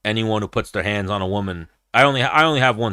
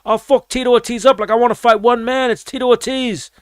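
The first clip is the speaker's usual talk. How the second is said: Lee Murray impression; 13 semitones higher, 3 dB louder than usual. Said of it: "he was like".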